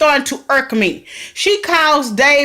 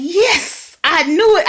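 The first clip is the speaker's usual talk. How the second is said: excited cheer; 6 semitones higher, slower than usual. Retold or imitated. Retold reaction to spotting Calvin.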